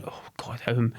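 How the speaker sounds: breathy whispered thought to himself; whispered despair. Retold or imitated. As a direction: whisper it like a private dread. Retold despairing inner thought.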